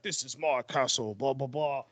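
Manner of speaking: a hint of a cheeky Scottish accent. Co-host mimics the show's host in the castle, light Scottish accent. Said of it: "in his little Scottish accent".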